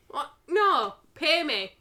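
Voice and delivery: usual speaking voice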